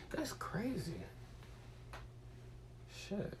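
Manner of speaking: whispered under his breath; soft whispered aside. Real, not acted. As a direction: breathe it out unvoiced.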